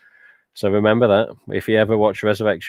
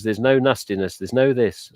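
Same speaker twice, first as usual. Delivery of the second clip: ordinary talk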